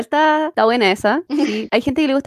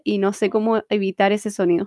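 ordinary talk

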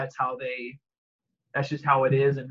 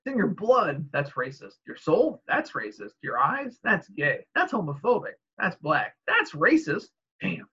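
mock-indignant back-and-forth quote; acted sitcom exchange; 3 semitones higher than usual. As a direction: pitch seesaws between question and retort.

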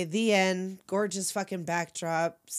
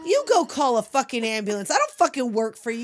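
louder, higher fed-up refusal; indignant rant; 4 semitones higher, 5 dB louder than usual. Acted. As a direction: tell him off as the furious heroine. Voicing Tess refusing, indignant.

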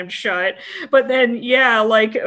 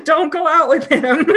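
high laughing mock advice; 8 semitones higher, 6 dB louder than usual. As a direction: laugh through the warning.

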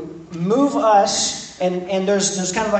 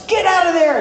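high, louder mock yell; angry mother yelling; 10 semitones higher, 5 dB louder, faster than usual. Mom in the store yelling at her kid, mock shout. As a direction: snap at the kid to get out. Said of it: "They're like".

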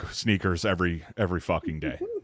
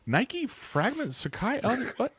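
mock incredulity; 12 semitones higher than usual. Host roasting co-host's top ten, mock incredulity. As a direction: act baffled, pitched way up. Acts out baffled reaction to the list.

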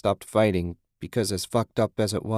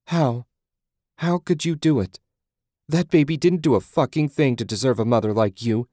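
higher, raised voice of disbelief; distraught outrage; 4 semitones higher, 5 dB louder than usual. A narrator's reading. Susan asking Julie how she could, appalled and raised. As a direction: demand an answer in appalled anger.

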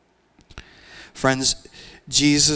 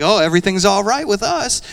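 breezy denial; 8 semitones higher, 5 dB louder than usual. Retold or imitated. Mock-breezy excuse to God.

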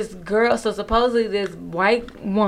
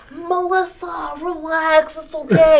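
pleading friends; 7 semitones higher, 3 dB louder, slower than usual. Retold or imitated. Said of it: "her friends are trying".

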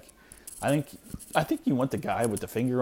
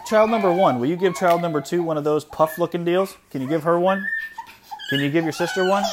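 loud, raised call across the room; 6 semitones higher, 8 dB louder than usual. Real, not acted. Dad telling his older kid to share a snack, loud calling out.

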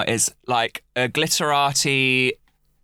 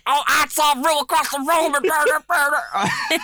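raspy Vanjie impression; 11 semitones higher than usual. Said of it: "It's like".

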